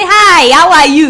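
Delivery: a slow greeting in a Nigerian Ibadan accent. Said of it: "I have Ibadan accent".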